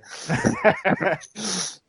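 a high laugh that falls away. Real, not acted. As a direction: laugh high at your own story. Laughing at own story.

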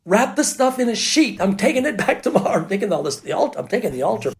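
high, urgent retold orders; acted-out alarm, voice jumping up; frantic orders. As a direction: rattle out orders in a mock panic.

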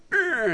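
strained grunt. Retold or imitated. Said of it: "he was just like".

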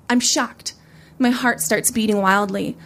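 plain reading voice

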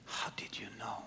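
hushed awed whisper; whispered in disbelief. Retold or imitated. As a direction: breathe the question in awe. Driver's hushed question.

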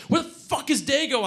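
high-pitched panicked yell. Acted. Narrator to friend on the porch, yelling. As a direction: yell it, voice jumping up. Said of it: "I yelled".

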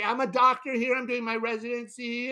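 a touch of her high, cheerful voice; lightly voiced proud young doctor; chatty sister. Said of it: "she's updating, like".

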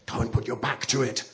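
ordinary delivery

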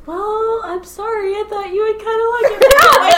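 very high, squeaky defensive apology; hammed-up high-pitched apology; mock apology. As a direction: plead it way up high, building to a loud peak. Acting out own apology to friend.

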